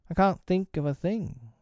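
high-pitched apologetic henchman; regretful. A narrator's reading. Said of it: "he said regretfully".

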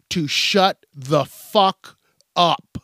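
every word of "to shut the fuck up" slowed right down, "shut" leading; slow, deliberate repeat. Shown as a demonstration. Ranting at mask complainers, slow and deliberate. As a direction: spell it out slowly so they hear it. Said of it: "I'm gonna say it again".

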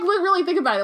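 ordinary talk, nothing marked

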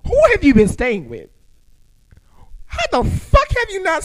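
high, loud mock outrage; incredulous outburst. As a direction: yell it in exaggerated disbelief. Acted outraged disbelief at her.

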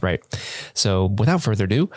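ordinary talk, nothing marked

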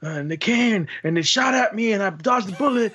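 high, breathless imitated child; excited kid voice. Kid telling parent a wild robbery story, high and excited. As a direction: tell it like an overexcited kid.

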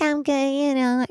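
put-on high, light girlish voice; imitating the girl. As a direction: chirp it back sweetly like the girl on FaceTime. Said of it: "She's like".

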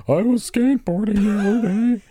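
high-pitched, weepy put-on voice; mock-weepy falsetto caller; Weepy Voice Killer. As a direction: confess in a high, tearful whimper. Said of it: "we have Weepy Voice Killer on the phone".

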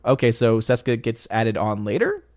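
ordinary talk, nothing marked

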